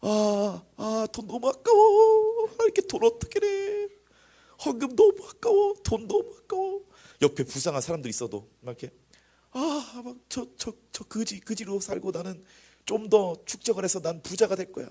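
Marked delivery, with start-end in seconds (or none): 0.0-6.8 hammed-up high, slow wail; very high, stretched mock lament; agonized miser. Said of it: "막 손이 벌벌 떨려". 9.5-14.9 high, grudging miser voice; hammed-up high-pitched greedy whine; Scrooge. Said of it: "스크루지 영감이잖아요".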